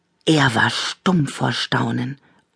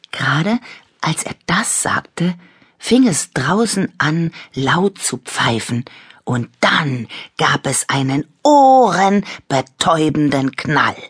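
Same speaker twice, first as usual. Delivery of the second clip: ordinary delivery, nothing marked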